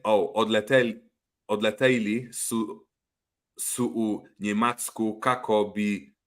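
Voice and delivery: slow, halting read of Croatian words; sounding out a foreign language for fun; attempting Croatian. Host reading a viewer's Croatian comment aloud, slow and halting. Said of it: "This isn't my language, by the way".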